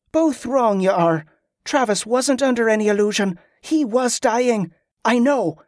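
high, certain, emphatic; resolute young Molly. A narrator's reading.

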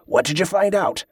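pushy, impatient question; demanding. Acted. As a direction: press him for the answer. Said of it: "Ambrose demanded".

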